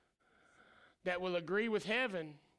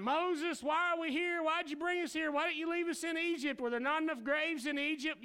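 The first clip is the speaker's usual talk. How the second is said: high, fast, frantic complaint; panicked whining; 6 semitones higher than usual. Retold or imitated. Israelites panicking and complaining to Moses in high voices.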